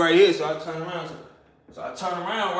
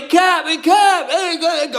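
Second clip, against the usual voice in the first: high, loud hailing by nickname; calling out; 10 semitones higher, 4 dB louder, slower than usual. Retold or imitated. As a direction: imitate homies hollering a name. Homies calling his nickname.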